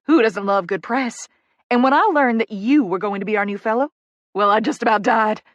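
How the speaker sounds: excited, lively Southern introduction; gushing Southern publicist. Acted. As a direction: gush to the new fellow with Southern enthusiasm. Poppy gushes in introduction.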